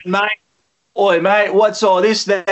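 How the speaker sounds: a hint of a cockney London accent; mock British accent. Artist jokingly voicing a cockney Brit for his remix. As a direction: play a cheeky London geezer in a cockney accent. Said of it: "a British remix".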